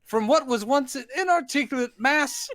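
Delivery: hammed-up ringmaster addressing the crowd; stage announcer. Showman presenting the monster to an audience, grand announcer voice.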